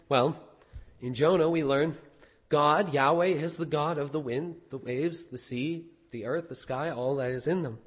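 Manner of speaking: usual speaking voice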